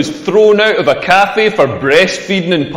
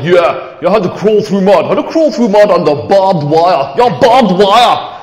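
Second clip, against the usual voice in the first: bragging mate. Acted. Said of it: "he's all like".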